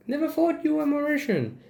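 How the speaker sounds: high-pitched imitation of a commenter; mock surprise. Mimicking a commenter on who looks Mauritian, high mock surprise. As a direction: pitch it well up, feigning astonishment.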